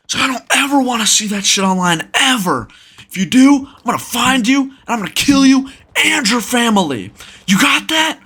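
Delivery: high, mock-furious warning; retold threat. Angry man threatening the storyteller over a video, high menacing rant. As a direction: imitate an enraged man laying down a threat, voice way up.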